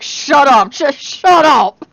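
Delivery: loud, high "shut up", second one drawn out; exasperated retold outburst. Teased Loki telling the teaser to stop, exasperated shout. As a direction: imitate someone fed up, raising the voice sharply.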